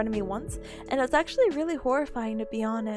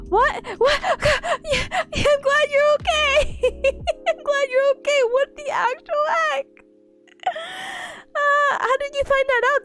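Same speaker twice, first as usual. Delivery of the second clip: shocked; 4 dB louder than usual. Real, not acted. Shocked by chat's seizure story.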